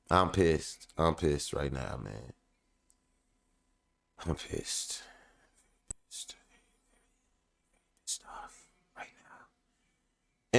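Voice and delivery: fading from low mutter down to a whisper; quiet seething whisper. Real, not acted. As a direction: let the anger sink into a whisper. Repeating anger, fading out.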